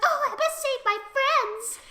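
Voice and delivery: high, melodramatic rescuer; mock heroic resolve. Fiva sees the flood come, high mock urgency. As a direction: declare it high, with hammed-up urgency.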